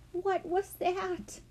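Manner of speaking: high, drawn-out startled question; spooked question. A narrator's reading. Child hearing an eerie sound, spooked and drawn out.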